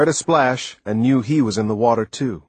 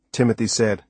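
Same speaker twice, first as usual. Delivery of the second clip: plain reading voice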